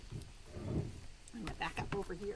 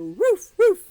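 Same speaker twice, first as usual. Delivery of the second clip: much higher and louder barks; dog barking; 11 semitones higher, 19 dB louder than usual. Pretend. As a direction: bark like an excited little dog. Barks inside the song.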